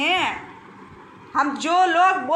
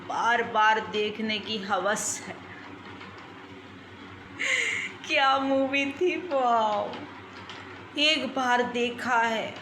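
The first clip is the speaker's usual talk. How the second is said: dreamy repeated refrain; 6 semitones lower, 8 dB quieter than usual. Acted. Repeated dreamy line, low soft.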